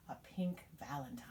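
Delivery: soft whispered reveal. Acted. Listing the birds' gifts, ending in a whisper. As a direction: drop it to a secret whisper.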